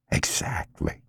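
breathy unvoiced agreement; whispered "exactly". A narrator's reading. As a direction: drop to a whisper.

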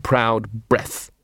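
ordinary delivery, nothing marked

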